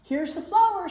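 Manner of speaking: high, loud happy shout; excited shout. Acted. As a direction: call it out proudly. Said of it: "shouted Jack".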